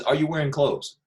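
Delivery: ordinary talk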